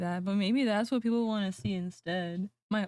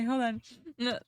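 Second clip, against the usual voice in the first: usual speaking voice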